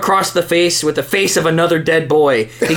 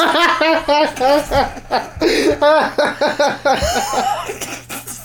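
laughing; 10 semitones higher than usual. Real, not acted. Reader cracks up.

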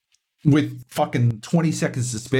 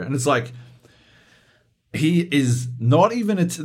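ordinary talk, nothing marked

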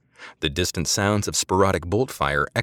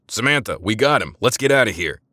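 shouting; 5 semitones higher, 4 dB louder than usual. A narrator's reading. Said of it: "and shouted".

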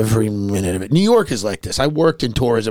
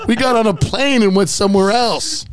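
ordinary talk

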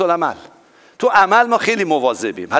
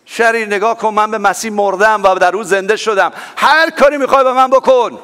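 defiant challenge; 5 semitones higher, 5 dB louder than usual. Acted. Defiant words shouted at Satan.

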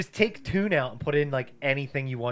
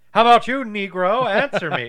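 much louder, higher mock interrogation; villain voice; 8 semitones higher, 13 dB louder than usual. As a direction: bark it like a hammed-up cop. Quoting movie villain.

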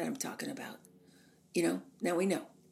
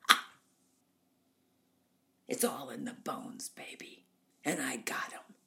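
put-on husky, whispery vain old star; Norma Desmond; 4 semitones lower, 4 dB quieter than usual. Norma brags about her bones, husky self-admiring imitation. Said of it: "She said".

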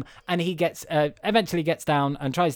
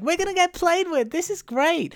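much higher giddy voice; excited toys. Retold or imitated. Host voices Buzz and friends, giddy and high. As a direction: squeal it high with glee. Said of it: "all them are like".

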